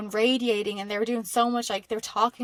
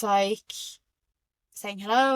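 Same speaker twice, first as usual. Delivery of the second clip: usual speaking voice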